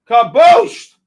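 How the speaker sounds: loud, gleeful burst; excited exclamation. Real, not acted. Streamer celebrating the gold drop, loud glee. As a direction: crow the made-up word in triumph. Gold crashes, trader exults.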